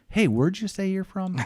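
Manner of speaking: high-pitched imitation of a curious stranger; stranger calling over. Stranger on a dolphin boat asks where he's from, high curious call. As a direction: pipe up high, imitating a passing guy.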